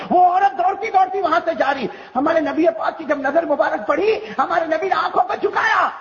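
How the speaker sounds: high, declaimed sermon narration; full-force preaching. Acted. Preacher telling of the Prophet and the running woman, fervent declamation. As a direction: proclaim the scene at high pitch.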